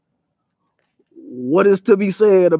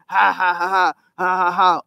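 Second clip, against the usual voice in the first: mocking laugh; 2 semitones higher than usual.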